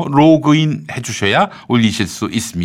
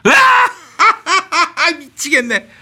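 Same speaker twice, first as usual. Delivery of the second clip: real laugh breaking through; much slower than usual. Real, not acted. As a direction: crack up mid-bit. (웃음이 터져서 못 참음)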